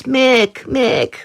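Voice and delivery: put-on high, thin old lady calling his name. Storyteller voicing an old lady calling Mick. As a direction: call out like a frail old woman. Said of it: "a little old lady goes".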